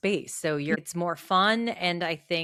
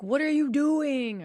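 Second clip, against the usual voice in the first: higher, drawn-out mock yell; 6 semitones higher than usual. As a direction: mildly yell at a movie character. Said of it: "people would be yelling at the screen".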